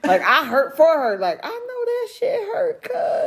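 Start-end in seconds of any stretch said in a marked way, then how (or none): 1.4-3.3 high, slowed mock cry. Mother mock-cries over her daughter getting flicked. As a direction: wail it half-jokingly.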